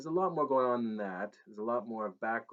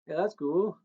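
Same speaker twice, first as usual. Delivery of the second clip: higher, louder, breezy casual; keeping it light; 7 semitones higher, 6 dB louder than usual. Acted. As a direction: play it cool and easy. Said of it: "I kept it light".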